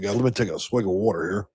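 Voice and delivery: normal talking voice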